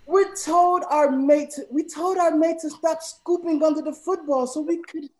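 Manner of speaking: loud, high-pitched, over-pushed British accent; attempted British accent. Shown as a demonstration. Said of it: "in a British accent".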